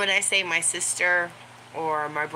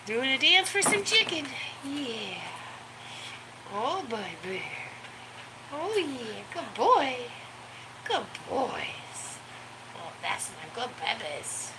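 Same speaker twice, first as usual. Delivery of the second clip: put-on baby talk to the dog; 5 semitones higher than usual. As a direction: coo over the good boy in a high voice. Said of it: "I was like".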